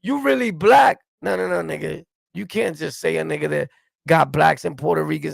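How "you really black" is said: mocking, much higher and louder, the way the other guy said it; mocking imitation. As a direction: sneer it back in a high, loud mock voice.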